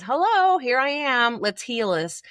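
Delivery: a playful mock voice for the problem saying hi; cheery new layer popping up. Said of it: "pops up and says".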